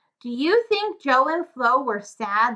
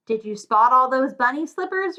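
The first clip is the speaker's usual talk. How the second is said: usual speaking voice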